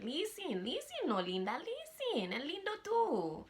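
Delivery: playful pleading. Pretend. Playfully pleading "listen, Linda" catchphrase.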